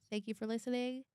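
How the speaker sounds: ordinary delivery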